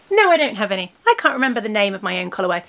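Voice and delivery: usual speaking voice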